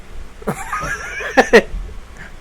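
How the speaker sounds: breathy, drawn-out laugh. Real, not acted. Host joking that they scared Ricky away, laughing. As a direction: let out a long, soft, airy laugh.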